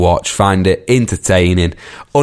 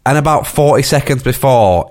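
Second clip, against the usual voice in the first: ordinary delivery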